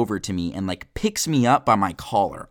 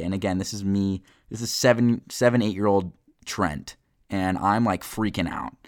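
normal talking voice

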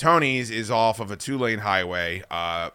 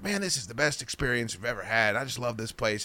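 understated retold praise; 9 dB quieter than usual. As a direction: say it quietly, almost to yourself. Acted reaction beside pizza on floor.

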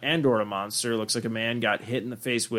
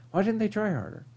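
put-on higher, softer, let-down little kid; mock disappointed kid; 7 semitones higher, 9 dB quieter than usual. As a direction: whine it like a sulking five-year-old. Five-year-old voiced, disappointed.

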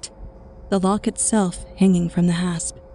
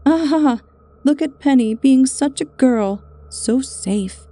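lightly voiced teasing guy, higher; mocking Eddie; 6 semitones higher, 4 dB louder, slower than usual. A hint of young men mocking Penny's caution. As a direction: tease her like a smug young man. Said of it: "Eddie would say".

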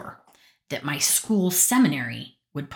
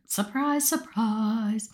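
high, sing-song sarcastic surprise surprise; mock it lightly, eyes rolled; 6 semitones higher, much slower than usual. Real, not acted. To listeners about the boy, sarcastic sing-song.

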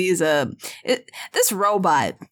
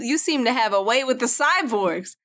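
usual speaking voice

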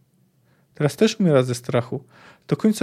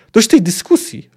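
loud angry snap, pitched up; warknięcie; 7 semitones higher, 7 dB louder than usual. A narrator's reading. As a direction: snap it out in fury. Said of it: "warknęła". (ostre, gniewne warknięcie)

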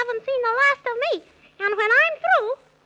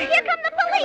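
panicked cry; 6 semitones higher, faster than usual. Acted. Police chasing them.

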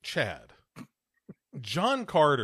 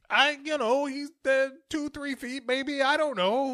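much higher, flustered evasive father; flustered dad; 13 semitones higher than usual. Acted. Father fumbles his daughter's question, high and flustered. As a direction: dodge the question like a caught dad.

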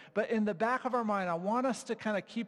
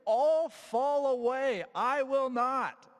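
loud, high, drawn-out vow; bold declaration; 8 semitones higher, 5 dB louder, slower than usual. Acted. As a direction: declare it slowly with heroic bravado. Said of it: "Peter declared".

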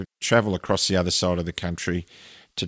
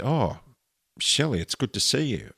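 lightly voiced creepy greeting, pitched up; higher-pitched mock-friendly greeting, a little creepy; creepy old man; 6 semitones higher than usual. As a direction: imitate a creepy man's sugary hello.

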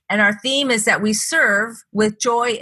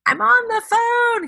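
much higher, slowed, annoyed protest; exasperated; slower than usual. Retold or imitated. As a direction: push him off while on a call. Snapping at husband during call.

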